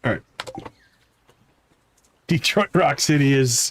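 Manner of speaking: normal talking voice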